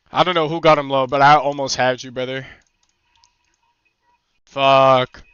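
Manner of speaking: ordinary talk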